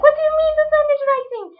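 very high, fast incredulous cry; shocked disbelief. Retold or imitated. Told by mom the sun is rising, very high shocked cry. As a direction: blurt it out in alarm.

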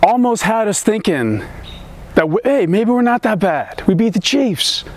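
high-pitched, rising excitement; mock hopeful hype. Mock hopeful hype about the Colts.

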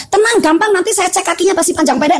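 menenangkan dengan enteng. Retold or imitated. Retold brisk reassurance to the patient. (meniru diri menenangkan, nada tinggi)

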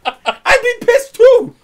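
very high, loud and slow squeal; mock outraged bird. Joking as the angry dead bird, high squeal.

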